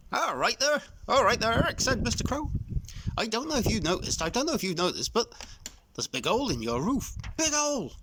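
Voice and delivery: put-on a nosy old bird bursting with news; chatty old crow. A narrator's reading. Mister Crow voiced.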